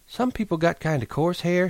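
high, smug and satisfied; complacent. Acted. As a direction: preen a little. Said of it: "she said complacently".

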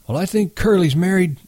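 a hint of an eager old man; old swamper. A narrator's reading. Swamper confides.

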